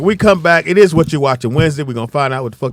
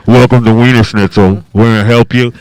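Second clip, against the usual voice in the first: mock cashier greeting; 8 dB louder than usual.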